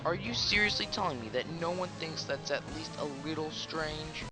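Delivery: normal talking voice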